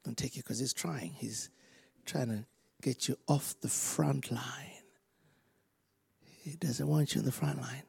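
soft, secretive whisper; whispering devil. Retold or imitated. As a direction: hiss it like a sly devil. Devil whispering his scheme.

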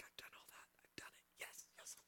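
breathless inner whisper; gleeful whispered self-talk; excited whisper. Retold or imitated. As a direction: hush it like a secret thrill. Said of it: "he gets a little boost in his spirit".

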